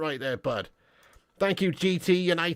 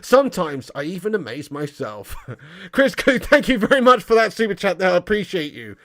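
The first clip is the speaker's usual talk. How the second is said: ordinary talk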